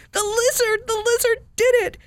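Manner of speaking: joyful cry. Acted. Mouse finds his friend Willie turned real, crying out in joy. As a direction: shout his friend's name in pure delight. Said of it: "cried Alexander".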